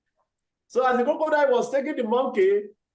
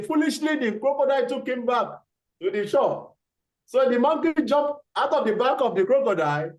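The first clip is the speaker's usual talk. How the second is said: normal talking voice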